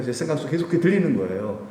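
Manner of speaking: normal talking voice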